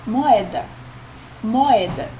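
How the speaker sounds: moeda with a small break between mo and eda; pause in moeda. Shown as a demonstration. Teacher showing class the hiatus, pause in moeda. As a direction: let the O and E of moeda sound apart. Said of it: "note que moeda há uma pausa".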